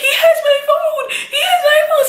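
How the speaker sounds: high, laughing yell after the thief; panicked yell. Retold or imitated. Girl yelling after the thief who took her phone, high panicked shout.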